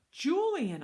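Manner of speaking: loud, high, incredulous cry; appalled outburst. Acted. Boy hearing who else was chosen, appalled. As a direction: exclaim the name in dismay. Boy reacts to Julian's name.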